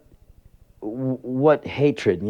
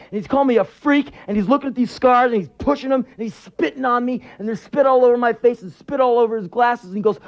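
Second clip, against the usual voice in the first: high, agitated storytelling; breathless-paced account pitched way up; intense spoken-word monologue; 4 dB louder than usual. Acted. Worker reliving the boss's attack, intense monologue.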